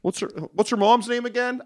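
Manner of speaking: high, hammed-up dismissive tone; sarcastic fake fan shrugging it off; mock fan voice. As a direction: brush it off in a put-on voice. Mock fan then dismissive answer.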